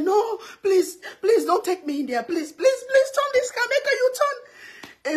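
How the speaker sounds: high-pitched, hammed-up begging; imitated desperate pleading. Other lady begs the driver for a U-turn, mock desperate pleading. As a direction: plead like a panicking passenger. Retold passenger begging not to cross.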